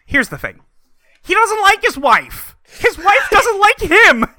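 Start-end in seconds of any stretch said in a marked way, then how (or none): 1.2-4.2 high, slow, disbelieving; incredulous. Real, not acted. Podcast host to cohost, marvelling at the plot in disbelief. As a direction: spell out the absurd setup slowly and high.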